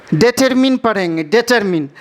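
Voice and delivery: डिटरमिन as dih-TER-min, short final i; correct pronunciation. Shown as a demonstration. Teacher gives students the right reading. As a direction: model डिटरमिन with a short "min", not "mine". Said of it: "डिटरमाइन नहीं पढ़ेंगे".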